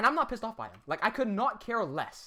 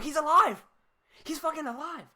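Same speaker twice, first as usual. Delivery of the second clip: excited; 8 semitones higher, 4 dB louder than usual. Retold or imitated. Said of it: "I'm like".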